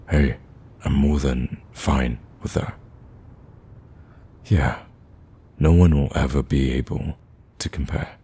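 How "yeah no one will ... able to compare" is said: hushed, trailing into a whisper; tender promise. Acted. Speaker making a promise to their date, hushed and tender.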